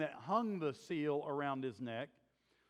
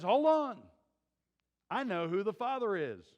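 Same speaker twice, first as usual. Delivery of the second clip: shouted Hold on, higher and louder, then settling; urgent shout; 5 semitones higher, 6 dB louder than usual. Retold or imitated. Tamar calling out to the men dragging her, loud urgent cry. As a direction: cry out to stop the crowd.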